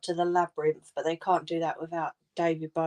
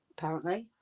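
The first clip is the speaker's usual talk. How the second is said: normal talking voice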